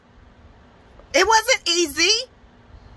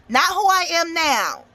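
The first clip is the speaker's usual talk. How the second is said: normal talking voice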